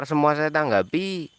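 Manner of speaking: ordinary talk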